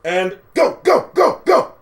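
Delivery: high, loud, urgent go signal; mock operation command. Team leader launching the staged operation, urgent command. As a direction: fire off the command to move in. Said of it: "sounds as if it was an operation".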